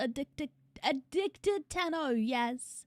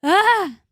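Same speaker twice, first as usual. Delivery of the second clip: a loud, higher cry of joy bursting in; excited yell; 6 semitones higher, 14 dB louder than usual. Real, not acted. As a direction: shout your arrival. Streamer bursts in yelling on arrival.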